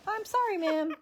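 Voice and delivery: high, meek mocking "I'm sorry, ma'am"; mock gas man apology. Gas man voiced in story.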